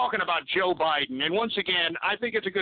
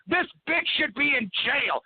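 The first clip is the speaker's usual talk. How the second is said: ordinary delivery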